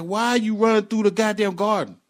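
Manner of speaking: high, loud exasperated demand; mock-yell at a misbehaving kid; worked-up retelling of a telling-off; retold scolding.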